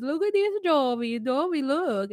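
put-on lightly accented coaxing call; a touch of her foreign accent, calling Jovi to look; light mock accent, pointing out the sights; imitating her accent. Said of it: "and she's".

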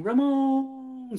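long, high stadium call of the name; drawn-out fan call across a ballpark; Ramon call. Retold or imitated. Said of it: "a whole big old Ramon call".

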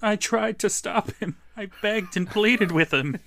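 high, hammed-up plea; mock pleading. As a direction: mimic a false innocent pleader. Mocking Alan's false innocent plea.

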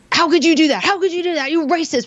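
high-pitched, hammed-up outraged yelling; imitated screaming. Sixth grader accusing a girl at recess, imitated screaming. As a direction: mimic a kid screaming accusations. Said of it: "Starts screaming at her".

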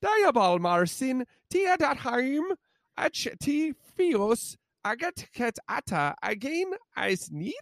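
slow, high-pitched botched Gaelic ad read; mock Irish Gaelic accent. Host trying an Irish beer ad very poorly, mock Gaelic accent. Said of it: "I don't even know what accent that was".

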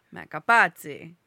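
loud Italian-accented name after a laugh; playfully insistent Italian accent on the name. Shown as a demonstration. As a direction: belt out Capazzi with an Italian accent. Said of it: "do the accent every time".

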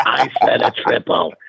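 slow, put-on Boston growl of insistence; a stubborn Boston barfly doubling down, slowly; imitated Boston accent. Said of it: "in that Boston accent".

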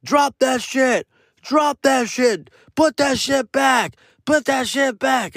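slow, high, commanding yells; yelling. Retold or imitated. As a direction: bark it like an angry mob. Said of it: "I stop hearing".

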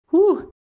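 a high, loud exhale of relief; relieved whew. Real, not acted. Guest steadying herself mid-story, loud relieved whew. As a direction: blow the emotion out in one breath. Relief after emotional confession.

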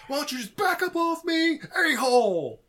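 high furious yelling impression; Nick Cage shout. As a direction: yell it like an unhinged action hero. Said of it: "the other Nick Cage where he's like".

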